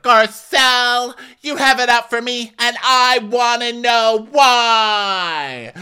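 high, loud, very slow whine; mock whiny Rinna. Rinna confronting Garcelle, high drawn-out whine. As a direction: drag out a whiny demand. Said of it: "Rena's like".

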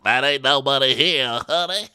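high playful put-on voice, loud; mock sassy voice. Imitating a cast member to her co-host. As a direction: sass it like a diva.

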